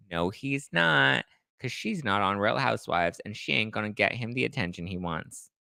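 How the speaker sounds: usual speaking voice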